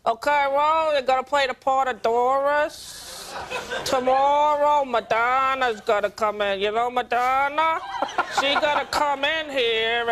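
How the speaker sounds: slow, drawling Bronx director. Retold or imitated. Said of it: "she goes".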